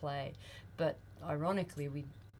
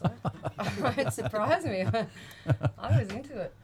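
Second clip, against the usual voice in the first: laughing through the words; amused, giggling as she talks; 5 semitones higher, 7 dB louder than usual. Real, not acted. Laughing about being snowed in.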